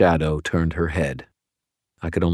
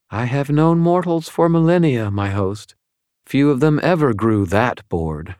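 high, cool fallen angel voice; an otherworldly angel, detached and probing; Lashiel's voice; 8 semitones higher, 3 dB louder than usual. A narrator's reading.

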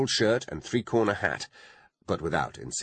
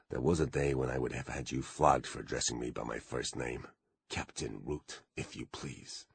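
softer, lower, haughty disdain; contemptuous; 6 semitones lower, 8 dB quieter than usual. A narrator's reading. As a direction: sneer it under the breath. Contemptuous imagined retort.

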